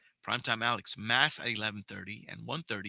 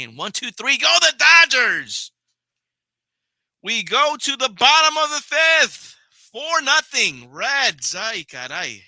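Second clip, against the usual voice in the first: much higher, louder, slow mock-announcer call; exasperated call; 10 semitones higher, 12 dB louder, slower than usual. Real, not acted. Streamer calling another Dodgers three-up three-down, loud exasperated call. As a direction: ham up the collapse like a stadium announcer. Fan groaning at a quick inning.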